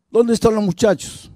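pregunta brusca en tono agudo, sin gritar; exigencia imitada. Retold or imitated. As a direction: imita a un padre que exige respuesta. Padre violento exige respuesta a esposa. (interrogatorio actuado, tono alto y volumen contenido)

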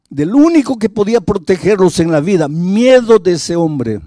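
clamor dolido, fuerte y agudo; dolor indignado actuado. Retold or imitated. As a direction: alza la voz con angustia, alargando el final. Padre dolido relata miedo de hijos. (lamento de padre, imitado a plena voz)

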